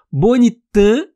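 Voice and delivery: Bonitã dito alto, terminando no A nasal sem N; nasal final vowel. Shown as a demonstration. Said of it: "eu tô usando na vogal anterior". (Bonitã com A final nasalado)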